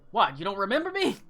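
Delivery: loud, high mocking taunt; taunting villain. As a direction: needle them like a gloating villain. Hades voiced by GM.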